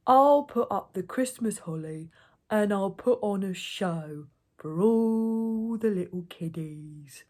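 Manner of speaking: slow ogre voice with stretched vowels. A narrator's reading. Slow ogre offers gatekeeper a show, drawling. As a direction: coax slowly, drawing words out.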